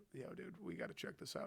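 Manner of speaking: whispered, as a trainer checking in; whispered trainer's question; hushed aside. Retold or imitated. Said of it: "I think they were probably asking".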